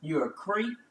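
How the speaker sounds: usual speaking voice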